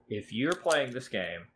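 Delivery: ordinary delivery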